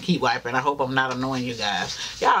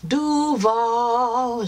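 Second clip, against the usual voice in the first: hyped call-out; 7 semitones higher, much slower than usual. Real, not acted. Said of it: "type in".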